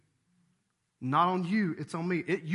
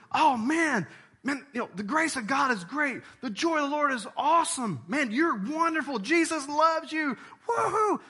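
high, loud gushing cheer; mock gushing; 11 semitones higher, 4 dB louder than usual. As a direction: hype it up like empty praise. Mocking a feel-good preacher.

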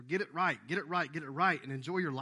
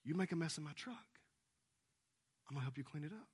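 tender dad; 9 dB quieter, faster than usual. Retold or imitated. Father reassuring his daughter in the truck, tender. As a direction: gently promise her she's still loved. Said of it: "I said".

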